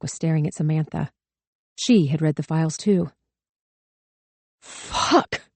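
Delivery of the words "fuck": whispered curse. A narrator's reading. Stunned curse under breath.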